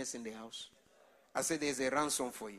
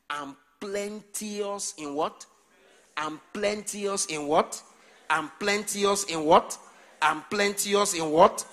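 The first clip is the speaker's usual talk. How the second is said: slow rising call getting louder; building call; 4 semitones higher, 8 dB louder, slower than usual. Real, not acted. Preacher prompting the congregation, building louder. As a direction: pull the answer out of the church.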